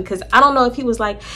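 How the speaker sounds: ordinary talk, nothing marked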